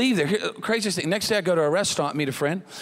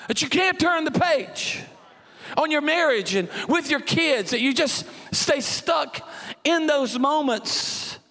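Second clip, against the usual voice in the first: preaching; 4 semitones higher than usual. Acted. Preaching to the congregation.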